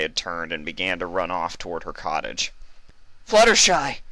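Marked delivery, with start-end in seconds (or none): 3.2-4.0 loud, high call after a friend. Acted. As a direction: call after her as she runs off. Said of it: "she called out".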